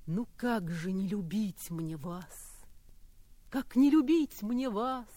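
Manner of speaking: slow, soft verse reading; poem recital. Acted. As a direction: read it tenderly, slowly.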